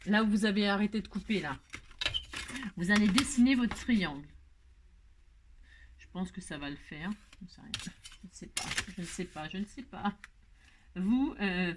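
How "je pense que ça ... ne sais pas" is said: quiet, quick self-doubting mumble; softly muttering, unsure; muttered aside. Real, not acted. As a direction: mutter it as if only to yourself.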